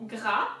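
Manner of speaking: "gras" with a throaty French R; isolated French syllable "gras"; French R emphasis. Shown as a demonstration. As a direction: say "gras" as one syllable, growling the R. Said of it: "high emphasis on the R".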